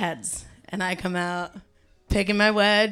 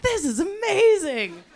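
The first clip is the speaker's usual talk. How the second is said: higher, slow awed delight; delighted kid; 9 semitones higher, slower than usual. Retold or imitated. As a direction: marvel at the crowd. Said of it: "I'm like".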